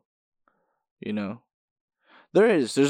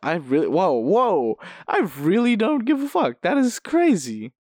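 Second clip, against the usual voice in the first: building from mild wonder to high-pitched disbelief; amazed realization; 6 semitones higher than usual. Acted. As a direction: astonish yourself, pitch climbing.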